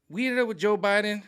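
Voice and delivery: loud, high, exasperated complaint; exasperated outburst. Real, not acted. Vlogger venting about who won, loud and high. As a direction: throw up your hands at the outcome.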